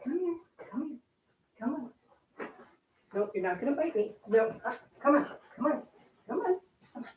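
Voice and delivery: soft high dog coaxing. Real, not acted. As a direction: coax the dog gently to come. Coaxing dog, avoiding bites.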